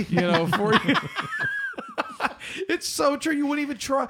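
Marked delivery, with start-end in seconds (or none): 1.0-2.6 squealing laugh, pitched way up; real shrieking laugh; high burst of laughter. Real, not acted. Laughing at deli man joke.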